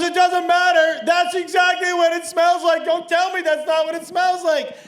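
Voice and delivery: high, insistent yelling; arguing woman. Retold or imitated. Woman arguing back at the man in the airport, yelling.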